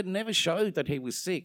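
ordinary talk

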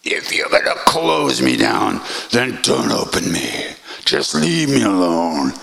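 a disciple yelling at his guru in despair; screaming at Maharaji. Retold or imitated. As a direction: rage at the guru from the roof. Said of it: "screaming at Maharaji".

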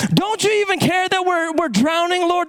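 high-pitched reproachful plea; disciples' protest. Retold or imitated. Drowning disciples to Jesus asleep in the boat, high reproachful plea. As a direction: plead indignantly, voice pitched well up.

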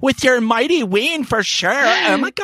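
high-pitched mock Valley Girl; Valley Girl accent. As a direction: put on an exaggerated Valley Girl voice. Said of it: "it was never that exaggerated".

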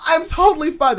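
high bright mock insistence; mock fake-fine girl. Imitating a messy girl insisting she's fine, high and mocking.

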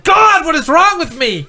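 high, slow anguished moan; self-pitying wail. Acted. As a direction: wail at your own failure. Stall owner berates himself.

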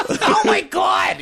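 very high, slow, shouted shriek; Estelle Costanza shriek. Retold or imitated. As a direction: screech like a Seinfeld mom. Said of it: "Estelle Costanza".

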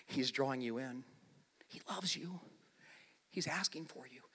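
soft, low, tender reassurance; hushed and loving, sinking towards a whisper. Retold or imitated. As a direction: gently coax a hurt sister. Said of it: "hears the love in Martha's voice".

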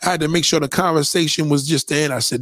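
ordinary talk, nothing marked

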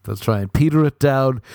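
usual speaking voice

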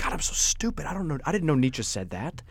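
hushed, unvoiced self-reproach; self-doubting whisper. To himself at the bar, a hushed self-reproach. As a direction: breathe it out like a private shameful thought.

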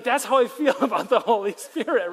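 loud, laughing admission; laughing confession. Retold or imitated. A churchgoer admitting unease about the Spirit, laughing. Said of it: "And you're like".

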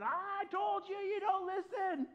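high, loud, slow furious cry; raging at heaven from the car; livid shout at heaven. Retold or imitated. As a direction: shake your fist and yell it at God. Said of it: "I was so livid".

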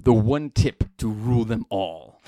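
low, slow, grand Lord of the Rings narration; epic movie trailer voice. Acted. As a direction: intone it like an epic fantasy trailer. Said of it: "Like Lord of the Rings".

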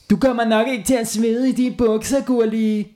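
high mocking kid voice; teasing kid. A narrator's reading. Classmate teasing Gurli again. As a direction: needle her playfully.

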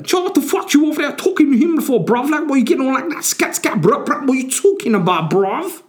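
put-on loud, very high-pitched caricature of a street accent; mock street accent. Contestant starting a fight over who he's talking to, mock street accent. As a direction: screech it in an exaggerated street accent. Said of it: "What sort of accent is that".

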